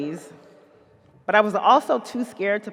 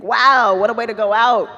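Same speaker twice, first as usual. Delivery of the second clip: excited; 2 semitones higher, 7 dB louder than usual. Retold or imitated. Excited reaction to Oprah rumor.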